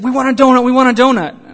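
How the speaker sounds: hammed-up excited demand; mock eager begging. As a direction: clamour like two women wanting a treat. Retold pleading for donuts.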